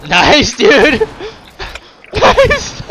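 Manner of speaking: shouting nice with delight; loud gleeful cheer; excited cheer. Real, not acted. Cheering teammate's kill.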